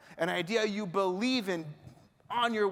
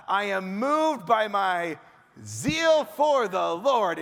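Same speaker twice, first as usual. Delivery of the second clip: slow, loud, drawn-out declaration; rabbi voice again; 5 dB louder, slower than usual. Acted. Elijah repeating his answer to God, loud and slow. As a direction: stretch out the zeal like a fervent rabbi. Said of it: "the rabbi voice".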